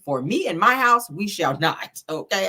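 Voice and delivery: ordinary talk, nothing marked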